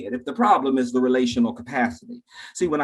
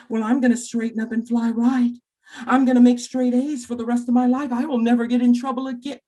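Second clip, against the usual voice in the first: put-on higher, earnest-sounding kid voice; mock reformed pupil; 7 semitones higher than usual. Kid back from suspension promising straight A's, mock-earnest high voice. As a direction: pledge it in a high, too-good voice.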